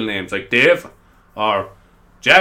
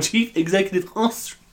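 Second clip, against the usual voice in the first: the same confident tone, landing on Ostrich with a higher rising lilt; retelling his own slip, still sounding sure; mock blunder; 6 semitones higher, 4 dB quieter than usual. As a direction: proudly say the wrong word. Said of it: "and I went".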